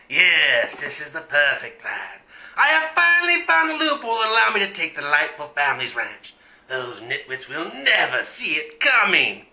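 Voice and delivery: low, hammy villain voice; scheming villain. Acted. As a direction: purr like a mustache-twirling villain.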